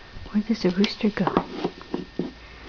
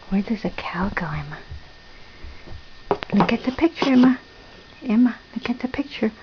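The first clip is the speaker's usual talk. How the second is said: usual speaking voice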